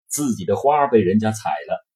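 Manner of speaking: ordinary delivery, nothing marked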